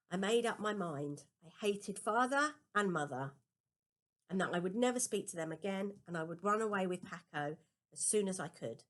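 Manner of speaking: usual speaking voice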